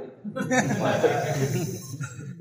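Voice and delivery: a real laugh breaking out; hearty laugh. Real, not acted. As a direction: laugh at your own punchline. Laughs at his own joke.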